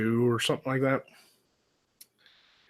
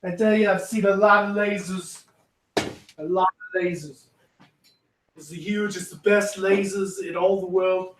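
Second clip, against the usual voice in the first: Trump impression; 6 semitones higher than usual. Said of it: "It's a terrible Trump impression".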